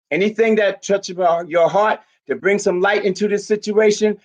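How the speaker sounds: ordinary delivery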